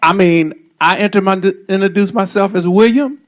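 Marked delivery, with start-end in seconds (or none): none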